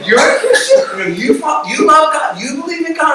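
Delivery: high, excited rapid-fire questions; amazed disbelief. Retold or imitated. Amazed finding secret fellow Christians.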